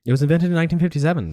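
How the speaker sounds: fast high excited. Real, not acted. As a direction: push the line out fast, high. Repeating fact after teasing.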